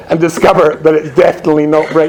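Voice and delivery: usual speaking voice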